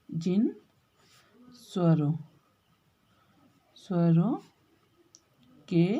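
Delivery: spaced-out dictation; slow dictation. Shown as a demonstration. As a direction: dictate slowly for students to write. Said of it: "तीन भेद बताए थे ना मैंने आपको".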